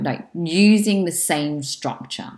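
ordinary delivery

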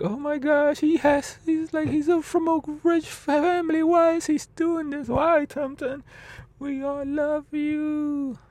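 high pleading, slowing at the end; friends' voice. Retold or imitated. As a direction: friends fretting over their passed-out friend. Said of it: "they're like".

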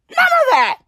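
high scoffing "None of that"; mock dismissal. Shown as a demonstration. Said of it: "repeated with different delivery".

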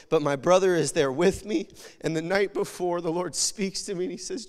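ordinary talk, nothing marked

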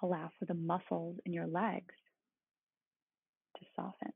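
soothing, unhurried meditation guide; calm meditative tone. Acted. Leads a guided body-scan meditation.

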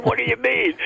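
high, laughing question; can't keep a straight face asking it; shrink's question through laughter; laughing while quoting. Retold or imitated. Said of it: "And he said".

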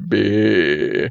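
"beer" drawled as a broad Australian "bee-ah"; broad Aussie accent on "beer", stretched vowel; thick Australian accent. Shown as a demonstration. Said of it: "in a really, really thick Australian accent".

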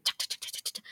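soft whispered chac sounds for spider legs at work; whispered clicking. Retold or imitated. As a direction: click it out under the breath like mechanical legs. Mother rebuilding her with spider legs.

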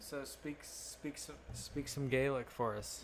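whispered coaxing request; hushed. Real, not acted. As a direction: lean in and coax quietly. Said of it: "prompting her in a hush".

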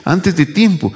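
voz aguda de demonios alarmados; demonios alborotados. Acted. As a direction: imitar su protesta, agudo y atropellado. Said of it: "ese alboroto hasta que aparece Jesús". (demonios agitados en tono agudo)

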